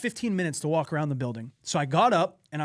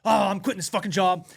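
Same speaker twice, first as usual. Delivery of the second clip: louder and higher, hot-headed and fed up; mock furious quitting; 3 semitones higher, 5 dB louder than usual. A fed-up worker at his desk, an angry outburst about quitting. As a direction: blurt it like you're done with everything. Storming decision to quit job.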